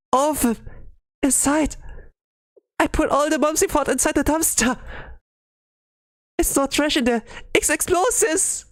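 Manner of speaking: high, frantic stammering; panicked confession. Acted. Haruka admitting the bombs to friends, high frantic voice. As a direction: spill it out in high-pitched panic.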